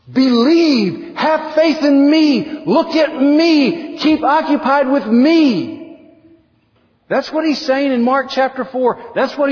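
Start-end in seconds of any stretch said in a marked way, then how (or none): 0.1-5.8 much higher, slow and intense, each phrase drawn out; earnest Jesus. Acted. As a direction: urge him to hold on, slow and lifted. Jesus pleads with grieving father.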